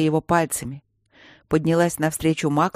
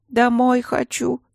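put-on higher, slow childish whine; whiny little girl; 8 semitones higher, slower than usual. A narrator's reading. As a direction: whine it like a tired small child. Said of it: "ныла младшая".

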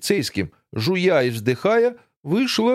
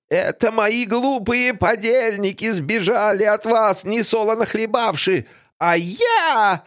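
high, slow menacing villainess; horror host; 8 semitones higher than usual. Acted. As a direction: gloat over her victims. Griselda threatens everyone. (зловещая злодейка)

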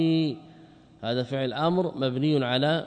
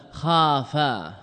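خافا stretched very slowly, falling; drawn-out word; much slower than usual. Shown as a demonstration. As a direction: draw out خافا for the class. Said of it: "إذا قلت ماذا؟". (خافا ممدودة ببطء)

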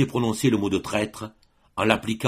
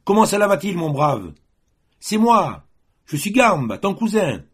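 high, loud forced cheer; calling out loudly; 9 semitones higher, 5 dB louder than usual. A narrator's reading. Adjudant shouts friendliness at armed cousin, loud call. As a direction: keep yelling a nervous welcome. Adjudant keeps hailing Matteo.

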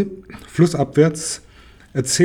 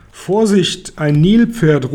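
raised, louder cry of warning; called warning; 5 semitones higher, 5 dB louder, much slower than usual. A narrator's reading. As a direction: call out a sudden warning to your friends. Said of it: "ruft Shabako". (warnend gerufen)

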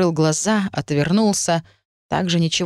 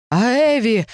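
louder, higher cry of alarm; panicked exclaim; 6 semitones higher, 4 dB louder, slower than usual. A narrator's reading. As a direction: call out in sudden panic. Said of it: "воскликнул король, в чьих глазах появилась паника".

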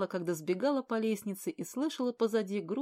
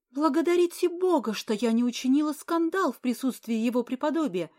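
much higher, loud furious tirade; 5 semitones higher, 7 dB louder than usual. Acted. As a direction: lash out, voice climbing high. Furious quarrel with countess.

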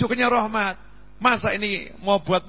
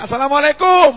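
hearty greeting; 9 semitones higher, 11 dB louder than usual. Retold or imitated. Student greeting imitated.

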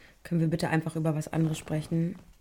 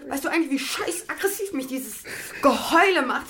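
high, loud furious screaming; shrill angry screaming at partner; 9 semitones higher, 5 dB louder than usual. Real, not acted. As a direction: yell at her to stop crying. Fight, she yells at crying partner.

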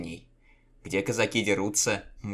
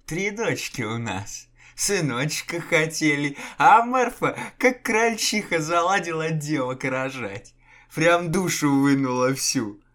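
high, slow rambling, half-mad cheer; dazed dreamy chatter; babbling delirious man; 8 semitones higher, 7 dB louder than usual. A narrator's reading. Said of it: "Несущий околесицу Степан".

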